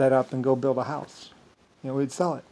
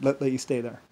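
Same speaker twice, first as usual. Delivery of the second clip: ordinary talk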